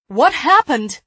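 loud, high shouted question. A narrator's reading. Woman shaking her brother for news, shouting. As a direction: shout it, demanding an answer. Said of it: "she shouted".

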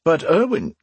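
high, falling plea of the name; surprised protest. A narrator's reading. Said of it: "stared at the man in surprise".

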